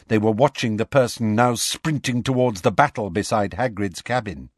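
usual speaking voice

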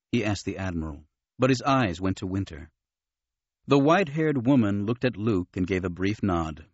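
ordinary delivery

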